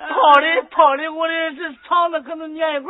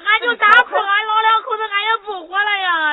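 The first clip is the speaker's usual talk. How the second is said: crying despair; 4 semitones higher than usual. Acted. Mother-in-law wailing that she'd rather die. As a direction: wail as if ready to give up.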